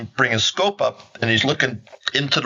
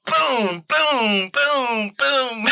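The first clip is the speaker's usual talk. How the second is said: high, drawn-out mock shotgun booms; imitated gunshots; 14 semitones higher, much slower than usual. Hunter retelling his rapid shots at the deer, high mock booms. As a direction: sing out each blast in a high voice.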